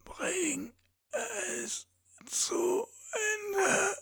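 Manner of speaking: dying man begging to be ended; agonised plea. A narrator's reading. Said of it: "stöhnte qualvoll auf". (qualvoll flehend, weinend)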